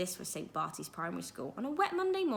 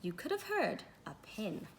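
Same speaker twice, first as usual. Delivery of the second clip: usual speaking voice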